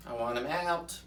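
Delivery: ordinary talk, nothing marked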